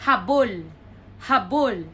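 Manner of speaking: "habol" as ha-BOL; stress on last syllable. Shown as a demonstration. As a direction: push the weight onto BOL in "habol". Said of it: "So the stress is here".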